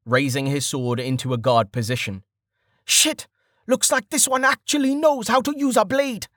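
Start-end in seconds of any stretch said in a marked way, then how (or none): 2.8-6.3 high, strained exclamation; alarmed realization. A narrator's reading. Archer realizing his foe can use a sword, alarmed. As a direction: blurt it in alarm, voice pitched up.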